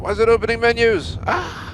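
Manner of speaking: panicked yelp. Real, not acted. Crash panic, menus opening.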